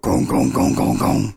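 low, hollow gonging sound effect; repeated clunky gong noises; waddling oven noise. Acted. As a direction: imitate a heavy oven clunking along.

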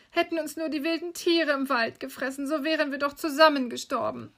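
put-on high, despairing little-girl voice; wailing lament. Gretel grieving for her brother, high lament. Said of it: "wie jammerte das arme Schwesterchen".

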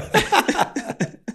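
high laugh. Real, not acted. Host laughing at story.

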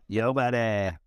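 raspy impression of a friend; lightly raspy, laid-back buddy greeting; Jonathan impression. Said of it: "Jonathan impression".

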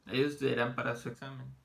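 emphatic classroom repeat. Shown as a demonstration. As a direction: project the sentence to the back row. Teacher repeats sentence louder.

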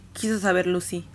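plain reading voice

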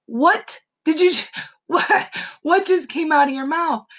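put-on high, loud, incredulous child's voice; a kid stunned at what mom said; shocked disbelief. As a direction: gasp it out, half laughing. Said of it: "I was like".

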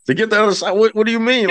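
imitating a puzzled crowd; confused audience. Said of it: "they're like".

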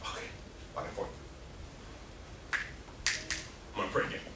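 whispering under his breath; muttered whisper to himself. Retold or imitated. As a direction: hush it, thinking aloud. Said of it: "he's like".

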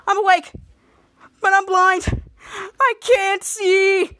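high, loud cry of alarm; hammed-up panic. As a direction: wail it, stretching the last words. Blindfolded character panics.

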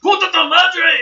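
high, loud Spanish curse; shouted insult. Acted. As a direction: scream the curse back. NPC swearing back in Spanish.

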